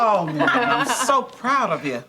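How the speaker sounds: slow, laughing congratulations; proud, delighted laughter. Acted. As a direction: let the pride bubble up through laughter. Congratulating someone on good news.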